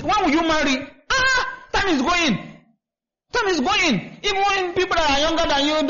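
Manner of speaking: high, sing-song mock of busybodies; mocking nagging people. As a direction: mimic the nosy people pestering him to marry, pitched high. Mocking people who nag him.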